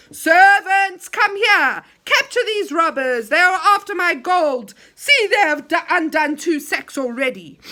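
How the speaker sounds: loud, high angry orders; commanding shout. Acted. Enchanter ordering servants to seize the robbers, loud. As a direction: roar for the servants.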